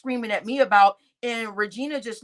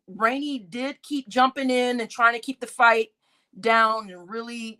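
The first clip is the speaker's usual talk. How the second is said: usual speaking voice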